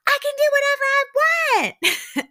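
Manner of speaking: imitated screech, very high and loud; full-volume mock tantrum; retold yell. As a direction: mimic a defiant screamed outburst. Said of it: "Lisa has been yelling this whole time".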